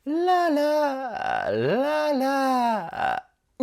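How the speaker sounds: ララー sung high and drawn out, creaky ending; vocal fry. Shown as a demonstration. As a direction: hold ララー high and slow, crackle at the end. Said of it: "みたいな感じで全部一息". (エッジボイスのララー)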